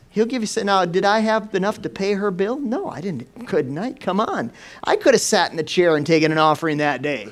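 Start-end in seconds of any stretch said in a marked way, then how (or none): none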